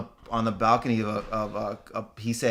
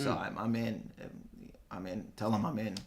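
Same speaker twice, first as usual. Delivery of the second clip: sheepish murmur; 4 semitones lower, 13 dB quieter than usual. Real, not acted. Quietly volunteering to lesbians.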